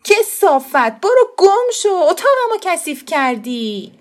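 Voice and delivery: put-on high, loud child's yell of disgust; furious shout. A narrator's reading. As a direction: scream him out of the room. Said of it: "خسرو فریاد زد". (فریاد خشمگین و بلند)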